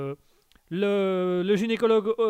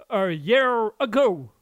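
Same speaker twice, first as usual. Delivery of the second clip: put-on English accent; 4 semitones higher, 9 dB louder, much slower than usual. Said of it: "on adore toujours ton accent".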